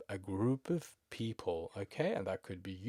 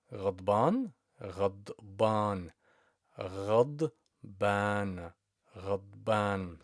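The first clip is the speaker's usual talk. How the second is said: slow model word. Shown as a demonstration. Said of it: "slow repeat".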